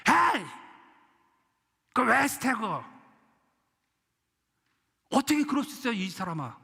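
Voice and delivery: hammed-up disciples' outrage; mock angry outburst. Preacher acting disciples scolding the woman, indignant shout. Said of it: "비난의 소리를 질러버립니다".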